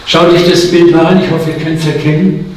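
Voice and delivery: ordinary talk, nothing marked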